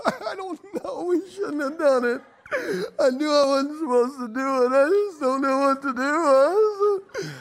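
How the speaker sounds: high, whimpering, crying voice through the words; retold sob at Taco Bell, half laughing; mock sobbing. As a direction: blubber it out like a broken man. Retelling his crying at Taco Bell.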